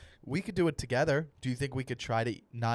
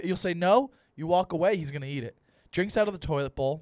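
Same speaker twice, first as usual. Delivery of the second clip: ordinary talk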